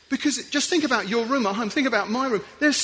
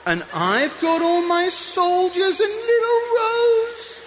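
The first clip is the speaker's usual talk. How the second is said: a boy playing soldiers, delighting in it; childlike glee; 9 semitones higher than usual. Preacher playing out a toy soldier game, high and gleeful.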